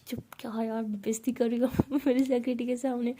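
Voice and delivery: whispered embarrassed hush. Acted. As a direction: hush her under your breath. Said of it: "ऐसे इशारा करती है lips से". (फुसफुसाकर चुप कराना)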